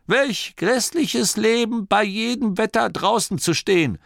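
high-pitched, excited reminiscing; insistent old man. A narrator's reading. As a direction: tell it high and animatedly. Old Torrid quotes grandpa's words. (aufgeregter Alter, hohe Stimme)

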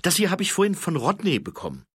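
put-on high, fast, keen young voice; eager high-voiced corporal reporting. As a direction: rattle off the report, high and quick.

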